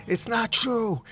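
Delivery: high cry of denial. A narrator's reading. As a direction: cry it out in disbelief. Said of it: "crying out".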